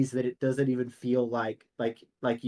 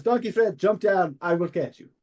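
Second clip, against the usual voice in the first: hearty hero calling out, pitched up; raised, declaimed hero voice; Demos calling; 4 semitones higher, 3 dB louder than usual. Acted. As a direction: call out a confident promise to catch someone. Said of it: "He goes".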